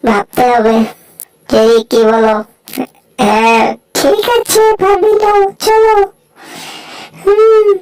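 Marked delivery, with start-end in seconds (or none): 4.0-7.7 much higher, slow squeaky character; squeaky cartoon voice. Small cartoon critter glaring back, squeaky high voice. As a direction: pitch it way up like a tiny critter.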